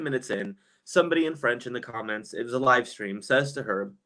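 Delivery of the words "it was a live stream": ordinary talk, nothing marked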